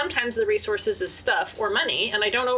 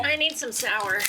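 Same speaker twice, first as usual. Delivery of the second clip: talking through a mouthful of candy; words round a bite of candy; cheeks stuffed full. Real, not acted. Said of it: "'Cause my mouth is full".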